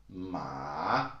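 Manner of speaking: "ma" dipping low, then rising; long falling-rising Mandarin "ma"; third tone. Shown as a demonstration. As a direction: drag "ma" down into a valley and back up. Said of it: "these are the four".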